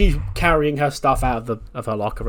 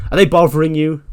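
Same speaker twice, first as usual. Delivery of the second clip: loud, higher protective challenge; confrontational demand; 5 semitones higher, 11 dB louder than usual. Retold or imitated. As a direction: step in and challenge them loudly. Acts out Candice stepping in.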